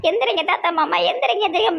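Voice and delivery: high-pitched imitation of a mourner's plea; mock wailing. Onlooker predicts her crying for grandpa to rise, mock wail. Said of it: "இனி கொஞ்சம் கொஞ்சமா அழுது".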